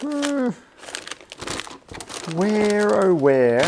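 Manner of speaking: drawn out, high and loud "where, oh where"; plaintive searching. Real, not acted. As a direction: call out for a lost thing, starting high and falling. Searching for a missing part.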